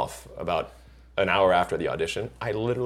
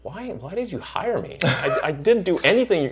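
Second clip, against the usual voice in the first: baffled pleading; 8 semitones higher than usual. Retold or imitated. Retells baffled question to director.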